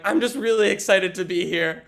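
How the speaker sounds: overeager excitement. Retold or imitated. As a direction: gush like a thrilled kid. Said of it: "And everyone's laughing".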